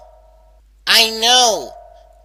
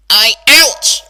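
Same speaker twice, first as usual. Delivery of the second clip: ouch of pain; 7 dB louder than usual. Acted. Pain cuts her off.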